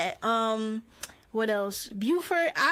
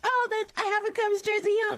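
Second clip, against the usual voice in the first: high, mocking out-of-towner voice; 11 semitones higher than usual. Mocking people who say the Chi, high put-on voice. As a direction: squeak it out like a Cubs fan tourist. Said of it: "The Chi is like".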